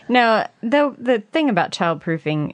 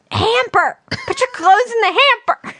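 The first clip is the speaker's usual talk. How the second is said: much higher, louder mock parental shout; imitated yell; 5 dB louder, slower than usual. Wife imitating husband yelling the correction, mock shout. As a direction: mimic a frustrated parent correcting himself. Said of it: "I hear you yell".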